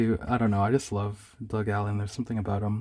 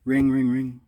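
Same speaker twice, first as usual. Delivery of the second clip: phone ring imitation; 4 dB louder, slower than usual.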